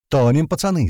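high, loud warning cry; urgent high yell; yelled alarm. A narrator's reading. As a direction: shout the alarm to the whole crew. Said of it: "заорал Котлета".

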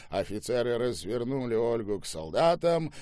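ordinary delivery

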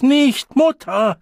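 high, drawn-out loud plea; crying out. A narrator's reading. Beaten child begging mother to stop, crying out. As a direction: wail it slowly at full voice. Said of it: "schrie er auf".

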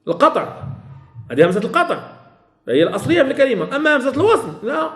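normal talking voice

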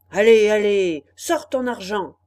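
loud robber's demand; shouting. Acted. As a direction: bark the order at him. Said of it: "criaient les deux brigands".

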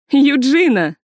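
bright, loud happy hail; smiling greeting. A narrator's reading. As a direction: greet her with a broad smile. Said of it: "широко улыбнулась Лили".

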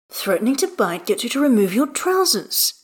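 teasing. A narrator's reading. Astarion needles Gale about his trousers, teasing. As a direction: needle him playfully with a lifted pitch. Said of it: "Astarion teases".

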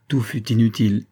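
plain reading voice

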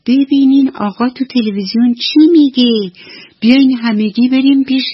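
put-on child calling out high and loud. A narrator's reading. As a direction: shout excitedly to dad across the room. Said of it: "با صدای بلند داد زد".

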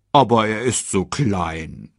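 langsam, bittend als Warzenschwein; beharrlich. A narrator's reading. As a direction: Pumba bleibt sanft hartnäckig. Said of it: "beharrte Pumba". (leicht gespielter, bittender Pumba)

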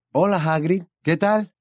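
put-on a cheerful young man. A narrator's reading. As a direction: Charlie greeting Hagrid. Charlie voiced.

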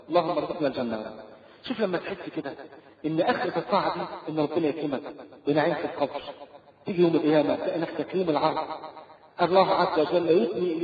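usual speaking voice

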